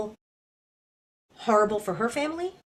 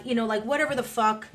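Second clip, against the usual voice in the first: ordinary delivery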